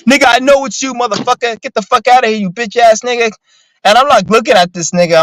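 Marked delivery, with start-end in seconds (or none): 0.1-3.3 mock cussing out. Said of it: "cussing me out".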